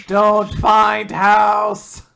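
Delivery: slow, loud, clumsy caveman voice; hammed-up caveman refusal; mock caveman. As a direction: bark it like a dim brute.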